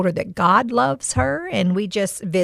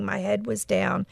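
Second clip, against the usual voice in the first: ordinary delivery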